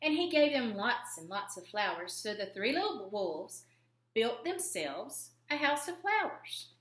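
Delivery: ordinary delivery, nothing marked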